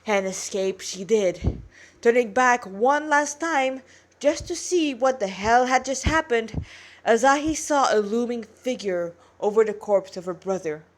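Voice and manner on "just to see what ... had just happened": ordinary delivery, nothing marked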